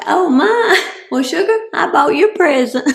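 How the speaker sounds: high, fast, flustered sweet talk with a giggle; high flustered "Well, sugar" cover-up; flustered southern belle. Retold or imitated. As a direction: bluff your way out with a sugary laugh. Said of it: "I'm like".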